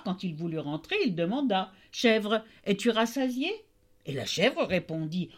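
usual speaking voice

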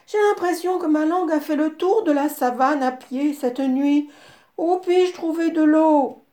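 zèbre assoiffé. A narrator's reading. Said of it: "dit le zèbre".

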